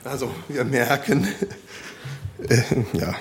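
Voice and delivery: laughing at himself. Real, not acted. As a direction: laugh through the words. Laughs at own forgotten stick.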